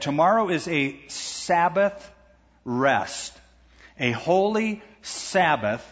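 "Sabbath" drawn out slowly and set apart; slow, emphatic reading that spotlights "Sabbath"; stress on Sabbath. Shown as a demonstration. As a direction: lean hard on each "Sabbath", slowly, with pauses. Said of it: "I'm emphasizing that word".

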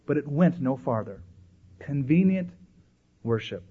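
normal talking voice

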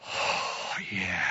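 breathy sigh of relief, low and soft; relieved sigh. Acted. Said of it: "we ought to just go".

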